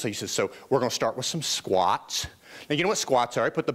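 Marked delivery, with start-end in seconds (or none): 0.3-2.3 low-pitched imitation of a Southern trainer; deep Southern trainer giving instructions; Southern accent. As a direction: play the coach with a Southern accent. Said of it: "he has this wonderful Southern accent".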